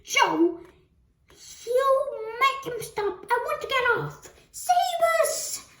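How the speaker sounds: high, loud plea for rescue; shrieky grandmother pleading to get off; panicked yell. A narrator's reading. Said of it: "yelled Grandma Georgina".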